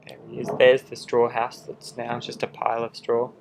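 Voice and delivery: usual speaking voice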